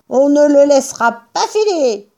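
high-pitched character vow; determined farmer. A narrator's reading. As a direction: state the resolve in a high voice. Bean jure de tenir bon. (résolution, voix haut perchée)

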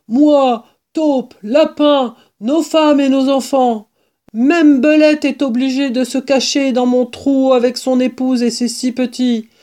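high, worked-up character voice; agitated badger. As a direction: lament the disaster emphatically. Badger laments the animals' plight.

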